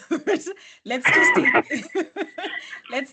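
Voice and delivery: laughing through the words; breaking into laughter. Real, not acted. Laughs at own joke.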